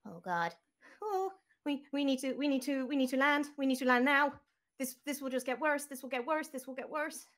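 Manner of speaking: high, loud, panicky pleading; a frantic little robot begging to land; panicked robot. Acted. Game master voicing BB to the crew, panicked and high. BB panicking about foul air.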